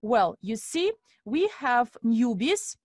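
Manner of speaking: usual speaking voice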